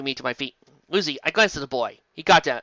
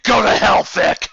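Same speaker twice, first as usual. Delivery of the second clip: loud, higher curse; angry yell; 4 semitones higher, 8 dB louder, slower than usual. Acted. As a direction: spit it out furiously.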